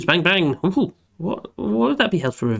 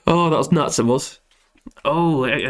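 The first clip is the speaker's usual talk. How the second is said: normal talking voice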